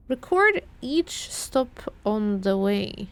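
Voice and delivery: slow, deliberate reread, weighing each word. Shown as a demonstration. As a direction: drag it out as if puzzling over it. Rereading the clue slowly.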